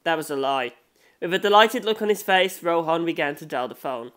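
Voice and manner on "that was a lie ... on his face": usual speaking voice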